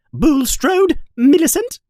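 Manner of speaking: teacher summoning a pupil; calling out. Acted. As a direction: ring the name out across the hall.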